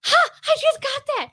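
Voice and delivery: very high-pitched mock girl voice; mimicked delighted realisation; imitated teen girl. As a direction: put on a squeaky-high girl catching on. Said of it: "one girl looks at me and goes".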